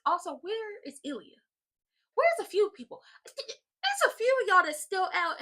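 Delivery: loud, high exasperated demands; exasperated calling out. Retold or imitated. Vlogger scolding absent wrestlers, loud exasperated call-out. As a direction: call out the missing roster, fed up.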